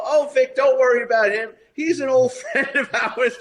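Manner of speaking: high, amused, laughing through it; laughing mobster. Retold or imitated. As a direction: brush it off with a chuckle like a wiseguy. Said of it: "coming down the stairs laughing".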